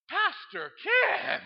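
high, very slow drawn-out call of the name; teen calling out. Retold or imitated. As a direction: stretch it out like a teen teasing. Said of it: "says right into the microphone".

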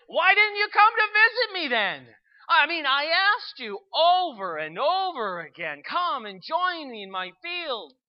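loud, high, pleading protest; bold questioning. Acted. Man challenges Holy Spirit over his absence, bold loud demand. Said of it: "feeling bold, he asks the question".

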